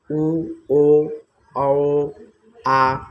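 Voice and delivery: उ ओ औ voiced loud and long with rounded lips; vowel demo. Shown as a demonstration. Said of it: "ऊ के उच्चारण में जो होंठ है वह गोलाकार". (उ ओ औ गोल ओंठों से ज़ोर देकर बोलना)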